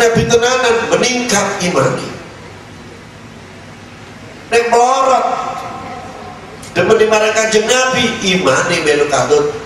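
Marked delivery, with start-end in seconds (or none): none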